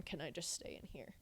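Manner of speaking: unvoiced scared whisper. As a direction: breathe it, barely audible. Scared child asking to stay.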